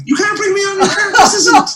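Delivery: very high, squawked mock panic; panicked protest. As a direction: replay a frantic plea, voice shooting up. Retells panicked protest before going on.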